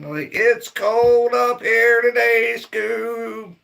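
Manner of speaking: playful cartoon impression for the viewers; cartoon stoner voice. Shown as a demonstration. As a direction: stretch it out high like a scared cartoon slacker. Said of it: "same line said plainly before".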